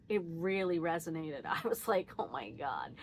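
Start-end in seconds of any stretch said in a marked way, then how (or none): 2.2-2.9 much lower, softer awed gasp; stunned realization. Real, not acted. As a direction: hit by recognition, voice drops. Sudden self-recognition.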